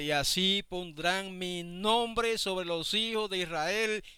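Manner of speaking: slow, solemn scripture reading; lectura solemne. Acted. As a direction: read it like holy writ, unhurried. (lectura solemne y pausada)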